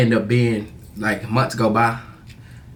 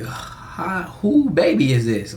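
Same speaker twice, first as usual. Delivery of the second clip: stunned disbelief; 7 semitones higher, much slower than usual. Retold or imitated. Retelling shock at baby photo.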